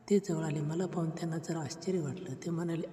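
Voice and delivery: usual speaking voice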